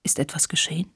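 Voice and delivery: softly concerned, hushed; worried question. A narrator's reading. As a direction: ask quietly, with a touch of worry. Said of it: "dass diese besorgt fragte". (leise besorgt)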